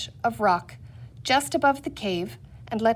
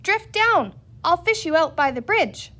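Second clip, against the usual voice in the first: high, raised call; cried out; 7 semitones higher, 3 dB louder than usual. A narrator's reading. Patsy shouts directions to Snavely in the river, raised. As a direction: keep calling across the current. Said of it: "cried Patsy".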